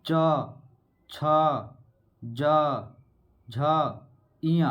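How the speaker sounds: च, छ, ज spaced out with long pauses; one letter at a time, clearly, to show the palate; sounding out letters. Shown as a demonstration. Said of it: "उसके बाद च बोलेंगे". (तालु से वर्ण अलग अलग बोलना)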